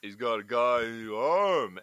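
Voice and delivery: Stallone impression. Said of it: "Stallone's like".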